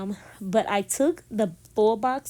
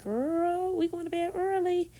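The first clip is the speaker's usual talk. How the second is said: voice of a tired body; 10 semitones higher than usual. Acted. Vlogger's body telling her it's bedtime, high comic voice. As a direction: play your own body begging in a much higher voice. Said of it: "my body's like".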